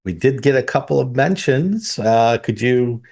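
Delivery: ordinary delivery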